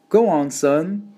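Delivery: slow, high-pitched motherly urging. A narrator's reading. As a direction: coax a reluctant son along, stretching the words. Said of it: "Mama urged".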